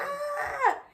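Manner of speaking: sudden high yelp of "Ah!"; startled cry. Retold or imitated. Exclaiming over karma coming back.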